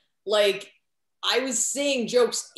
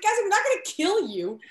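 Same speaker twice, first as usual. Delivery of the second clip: high-pitched pleading insistence; exasperated reassurance; 10 semitones higher than usual. Retold or imitated. Performer coaxing a panicked volunteer onstage, exasperated reassurance. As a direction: coax them in a much higher voice.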